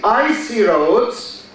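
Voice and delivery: "I see roads" with I stressed like "icy"; slow, lean on "I" in "I see roads"; teacher demo stressing "I". Shown as a demonstration. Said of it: "the stress is on the first syllable".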